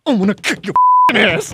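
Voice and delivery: loud, high threatening shout. Retold or imitated. Actor threatens the guy mid-tirade, shouting. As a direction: mimic an enraged actor's threat. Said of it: "he's yelling at the guy".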